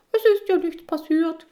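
very high, loud squeaky man's voice. Acted. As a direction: squeak it out cheerfully. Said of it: "piepst er".

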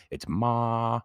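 "ma" held high and level on one note; first tone. Shown as a demonstration. Showing the Mandarin tones, first tone. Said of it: "first tone, monotone".

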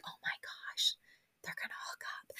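hushed, gossipy whisper, almost no voice. Retold or imitated. Podcaster whispering to listeners as the chef goes in. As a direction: lean in and whisper the juicy guess. Watching chef enter Luann's room.

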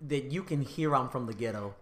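ordinary talk

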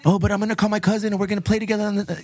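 put-on quick, high pleading kid voice; fast whiny kid. Dad imitating his son begging to play, fast and high. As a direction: rush it out like a begging child.

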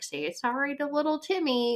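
put-on high-pitched, coaxing grown-up to a child; parent or teacher. Parent telling child to apologize, high coaxing voice. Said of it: "our parent or teacher is like".

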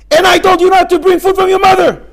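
high, very loud yell; furious shout. Acted. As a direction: scream at her in rage. Husband yells at wife.

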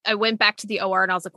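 ordinary delivery

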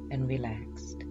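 slow, soft hypnotherapy voice; hypnotic induction voice. Acted. Leads hypnosis relaxation induction.

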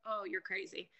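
low, soft, muttered to herself; under her breath, stunned; quiet disbelief. Retold or imitated. Reacting to scammers' money demands, low and soft. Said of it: "I'm like".

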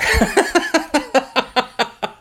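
high, loud burst of laughter; long giggling fit; real laugh. Real, not acted. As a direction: crack up at your own joke. Laughs at own joke.

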